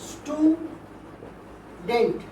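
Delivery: "Student" very slowly, long pause before dent; split into two syllables. Shown as a demonstration. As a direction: break "student" in two: stew, then dent. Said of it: "দুবারে উচ্চারণ করতে হচ্ছে".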